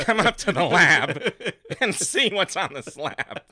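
loud campy sing-song, laughing; hammed-up Paul Lynde; Paul Lynde impression. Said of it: "That was Paul Lynde doing it".

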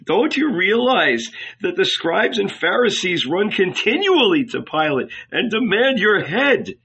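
urgent plea. A narrator's reading. Tearful rabbi pleading urgently.

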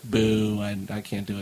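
low, soft mock disappointment; let-down booing. Film fan recalling the movie that lost him, deflated boo. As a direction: drop right down into a deflated boo. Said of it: "I was like".

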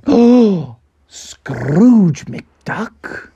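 big high 'oh', then the name in wonder; awed surprise. Acted. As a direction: react amazed to a famous name. Ducks react to famous name.

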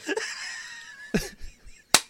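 high, breathless laugh; shrieking laugh. Real, not acted. Laughing at the cured chocolate addiction, a high breathless squeal. Cackling at the joke.

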